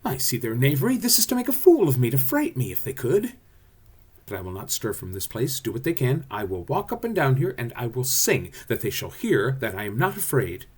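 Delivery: blustering workman putting on bravery; animated stage voice of a man insisting he isn't scared; Bottom. Acted. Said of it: "Here he goes".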